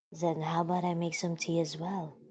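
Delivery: soft and timid; soft shy voice. Acted. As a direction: offer it softly and shyly. Voicing Yuri softly.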